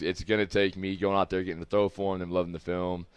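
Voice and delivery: usual speaking voice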